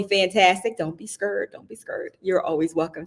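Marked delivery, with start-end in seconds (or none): 1.5-2.2 whisper. Real, not acted. Coaxing viewers into the room.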